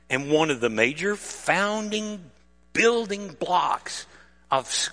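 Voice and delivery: ordinary talk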